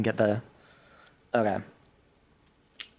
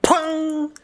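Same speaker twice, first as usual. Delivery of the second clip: high, ringing "pong" sound effect; imitated bolt launch; 12 semitones higher than usual. Game master narrating a bolt shot, imitating it. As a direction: mimic a twanging bolt on a high note.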